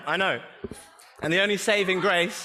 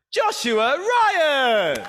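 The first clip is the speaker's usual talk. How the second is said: much higher and louder, each name stretched slowly; big announcer introduction; 8 semitones higher, 5 dB louder, much slower than usual. Acted. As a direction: draw the name out like a game-show host. Host announces the chair.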